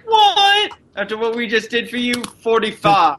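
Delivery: high, fast indignant squawk; mock outrage at the price; outraged haggling. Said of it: "I was like".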